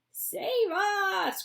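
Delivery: put-on high loud panicked old woman; terrified plea; cried. Grandma Josephine as the roof falls, panicked. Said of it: "cried Grandma Josephine".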